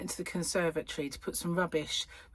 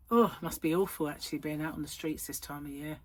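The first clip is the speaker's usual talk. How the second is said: ordinary talk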